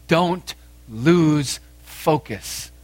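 "don't lose focus" very slow, word by word; slow, emphatic. Acted. Same counsel repeated word by word.

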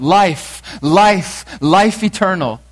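loud, high, separate cries; crying out. Acted. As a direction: cry it out as you flee. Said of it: "crying out".

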